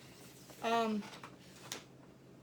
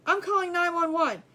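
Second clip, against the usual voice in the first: louder, higher urgent announcement; insist, frightened and firm; alarmed; 6 semitones higher, 6 dB louder than usual. Retold or imitated. Alarmed wife to dazed husband.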